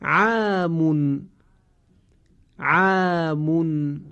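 عامون drawn out very slowly with a thin آ; slow recitation. Shown as a demonstration. Teacher reciting عامون slowly as a model. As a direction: stretch عامون like Quran recitation. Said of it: "صدای کشیدهٔ آ قرار گرفته".

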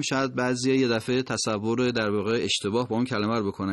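usual speaking voice

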